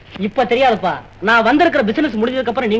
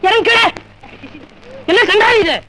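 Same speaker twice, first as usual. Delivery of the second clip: high-pitched indignant order; outraged yelp; 8 semitones higher than usual. Acted. Director to actress who wants to marry him, outraged yelp. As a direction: shout it out, scandalised.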